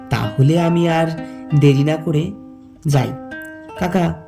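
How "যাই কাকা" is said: usual speaking voice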